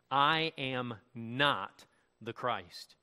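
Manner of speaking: slow, weighted "I am not the Christ"; emphatic denial. Retold or imitated. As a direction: spell out "not" slowly, word by word. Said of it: "as clearly and emphatically as possible".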